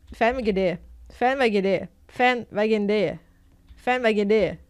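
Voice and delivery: ordinary talk, nothing marked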